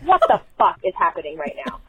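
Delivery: normal talking voice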